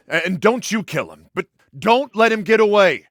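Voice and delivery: loud, high, emphatic command; urgent order. Acted. Urgent orders during a chase.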